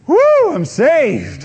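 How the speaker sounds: high, loud, slow whoop of relief; relieved whoop. Acted. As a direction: sheer relief at being rescued. Rescued person's relief at police lights.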